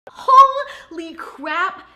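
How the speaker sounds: loud, high, drawn-out amazement; amazed exclamation. Real, not acted. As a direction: blurt it out, stretching each word. Opening reaction to the movie.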